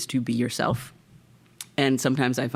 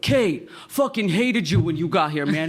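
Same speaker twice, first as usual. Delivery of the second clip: Philly guy impression; 7 semitones higher than usual. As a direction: do a brash Philly fan. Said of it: "I'm just replicating what he delivered".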